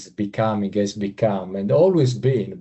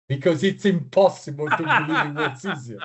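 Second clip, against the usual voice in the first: laughing through the punchline; chuckling while talking; 5 semitones higher than usual. Real, not acted. Joke about the survey result.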